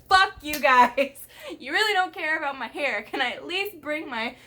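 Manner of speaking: hammed-up diva fury; mock outraged. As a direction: blow up in exaggerated indignation. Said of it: "And I was like".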